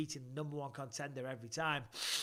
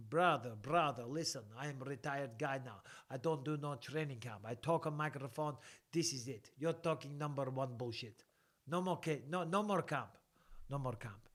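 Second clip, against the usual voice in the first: mock Russian accent, falling phrases. Retired fighter turning down a training camp, Russian accent. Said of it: "I can do a Russian accent".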